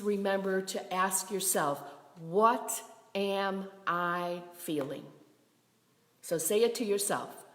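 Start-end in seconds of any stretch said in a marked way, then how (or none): 2.2-5.1 "What am I feeling" at half pace, word by word; very slow, reflective self-questioning. Shown as a demonstration. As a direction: set each word of "What am I feeling" apart. Models question to ask oneself.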